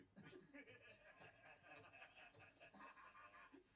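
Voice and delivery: long laugh. Real, not acted. Man cracking up over the World War Two answer, laughing. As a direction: laugh on and on, softly.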